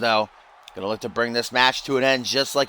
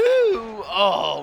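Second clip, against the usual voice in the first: voice crack; 9 semitones higher than usual. Real, not acted. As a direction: voice cracks on a high shout. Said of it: "that voice crack".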